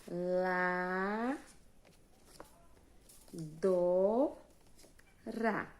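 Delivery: "La", "Do", "Ra" one at a time with long pauses; isolated syllables. Shown as a demonstration. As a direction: stretch "La" and "Do" upward, drop on "Ra". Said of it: "Eu abri a boca cinco vezes".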